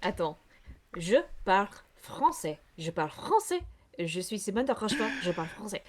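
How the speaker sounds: warming up a French voice; speaking French in character practice; French accent. Acted. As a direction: rehearse French phrases to find the accent. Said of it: "this stupid French voice".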